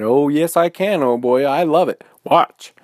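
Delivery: excited talking bear showing off; eager boasting. Acted. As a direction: brag excitedly, raring to go. Bear boasts he can ride.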